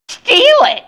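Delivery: very high, loud indignant screech; outraged. A narrator's reading. Woman whose child is called a thief, outraged. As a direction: throw the accusation back shrilly.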